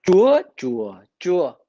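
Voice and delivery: "Chúa, chúa, chúa" on three different tones; Vietnamese tone contrast. Shown as a demonstration. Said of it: "can you tell the difference here".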